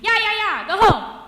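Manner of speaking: very high, loud screech; piercing yell, high and loud; screeched shooing. A narrator's reading. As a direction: shriek at the birds to chase them off. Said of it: "she screeched".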